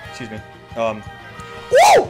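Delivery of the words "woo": very high, loud whoop; cheering whoop. Real, not acted. Streamer celebrating a teammate's play, cheering whoop.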